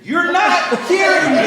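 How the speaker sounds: laughing, loud, high mock tantrum; mock furious wife. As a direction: ham up the wife refusing to talk feelings. Retold quarrel, wife voiced.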